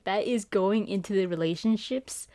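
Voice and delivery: ordinary talk, nothing marked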